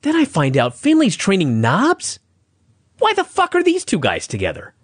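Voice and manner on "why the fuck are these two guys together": high-pitched baffled rant; exasperated anger. Real, not acted. Podcaster fuming to co-hosts over a wrestling segment, exasperated. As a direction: rant in disbelief, pitch jumping up. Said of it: "I was so mad".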